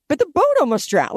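defending the disciples with playful outrage; mock protest. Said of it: "You're like".

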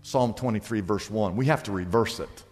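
hushed preaching voice under music; soft altar call. Acted. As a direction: draw them in gently, like an invitation. Quiet altar-call style over music.

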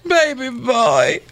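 melodramatic Southern mother, begging; Southern accent. Acted. Mother begs son to let her back, Southern accent. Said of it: "do this in a Southern accent".

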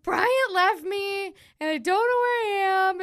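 high, wailing mock sob; imitating a bawling drunk. Drunk friend calling in tears, high wailing mock sob. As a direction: wail it like a lost, crying drunk. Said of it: "She's like".

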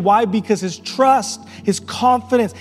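fervent pulpit preaching, hammering not; preaching at full force. Acted. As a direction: preach it, building with every line.

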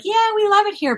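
high-pitched mock delight; false enthusiasm. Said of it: "give them this false hope".

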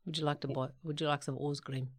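soft, low South African offer; South African accent. Acted. Offering someone dessert, South African accent. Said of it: "one word in South African".

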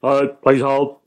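curt mock brush-off; park the caller without interest; unhelpful receptionist. Shown as a demonstration. Receptionist puts caller on hold, curt. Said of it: "a very different response from".

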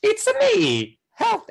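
high, slow cartoon Italian voice; squeaky Italian plumber impression; Mario voice. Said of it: "like Mario from".

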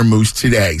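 ordinary talk, nothing marked

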